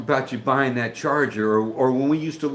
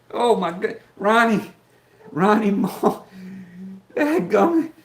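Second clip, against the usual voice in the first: much higher, louder excited yelps of his name; real excited surprise; 10 semitones higher, 4 dB louder, slower than usual. Real, not acted. Streamer spotting his old buddy in the chat, excited surprise. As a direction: caught off guard, thrilled and laughing.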